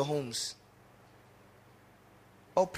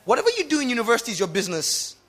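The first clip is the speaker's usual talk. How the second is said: exasperated parent; 7 semitones higher, 7 dB louder, faster than usual. Acted. Parent lecturing teen.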